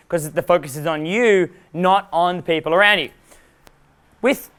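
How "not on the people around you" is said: ordinary delivery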